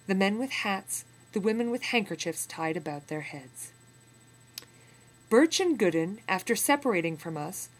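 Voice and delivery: put-on steady, measured reading of an old memoir; story narration. A narrator's reading. Teacher reading a memoir passage to students, in measured narration. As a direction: read the passage to the class with weight.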